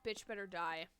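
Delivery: normal talking voice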